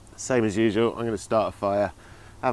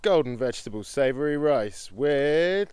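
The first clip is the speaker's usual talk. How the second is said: hammed-up higher menu announcer; mock dinner announcement; 3 semitones higher than usual. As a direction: present the menu like a grand reveal. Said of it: "Drum roll, please".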